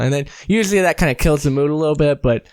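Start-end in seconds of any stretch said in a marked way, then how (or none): none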